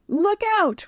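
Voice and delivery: high urgent call. A narrator's reading. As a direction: shout a warning. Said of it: "Ryan called".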